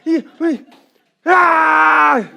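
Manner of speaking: put-on high loud crying shout like a fussing baby; mock baby cry, high and loud; imitated baby wail. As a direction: bawl like an infant who can't say what's wrong. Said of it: "그냥 우는 거밖에".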